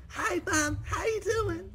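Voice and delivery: put-on very high squeaky kid. Kid answering mom, joking.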